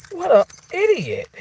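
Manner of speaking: high, sharp scoff at him; exasperated insult. Real, not acted. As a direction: spit it out in disbelief. Venting at the mechanic.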